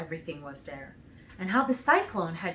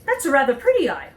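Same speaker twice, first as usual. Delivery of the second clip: higher, louder admiring Munchkin; 6 semitones higher, 9 dB louder, faster than usual. A narrator's reading. The Munchkin admires the painted eye, high and loud. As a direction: pipe it up, pleased. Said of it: "remarked the Munchkin".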